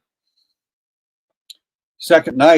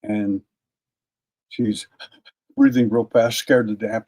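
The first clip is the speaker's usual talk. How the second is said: ordinary talk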